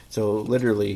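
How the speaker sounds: ordinary talk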